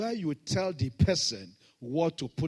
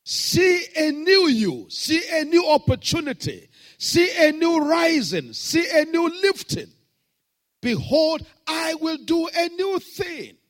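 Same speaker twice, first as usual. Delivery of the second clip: shouted, much higher, slow preaching refrain; full-force preaching; 14 dB louder than usual. Acted. Pastor declaring new things over the congregation, shouting high. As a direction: hammer each see a new line.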